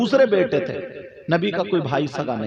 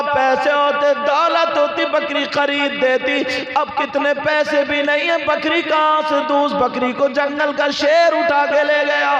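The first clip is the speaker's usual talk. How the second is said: full-force preaching; 11 semitones higher, 4 dB louder than usual. Acted. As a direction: cry the story out to the crowd at full force. (ऊँची, ज़ोरदार तक़रीर की आवाज़)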